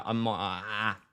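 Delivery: ordinary talk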